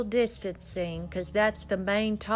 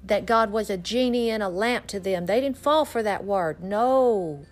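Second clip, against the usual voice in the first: loud, driving sermon at full force; fiery preaching; 3 semitones higher, 4 dB louder than usual. Acted. Preacher urging his listeners to get their ministry going, loud fiery preaching.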